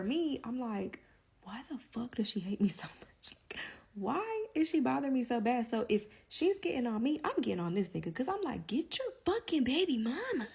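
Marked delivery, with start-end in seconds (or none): 1.4-5.7 hushed, exasperated whisper; frustrated whisper. Retold or imitated. Wondering why the woman hates her, exasperated whisper. 8.7-10.5 high, pointed mock demand; sassy demand. To her man about his ex, high sassy demand.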